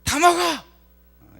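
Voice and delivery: little brother shouting at big brother; angry shout. Retold or imitated. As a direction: yell it, fed up with your brother. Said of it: "있는 힘껏 집어던지면서 뭐라 그래요".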